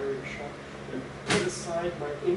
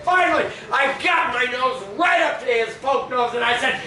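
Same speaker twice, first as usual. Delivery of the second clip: higher, loud frantic storytelling; excited shouting; 9 semitones higher, 9 dB louder than usual. Retold or imitated. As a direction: shout the fight out breathlessly. Retelling a wild brawl.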